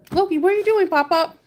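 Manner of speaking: high, sing-song talk to the dog. Real, not acted. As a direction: coo at the pet. Talking to her dog.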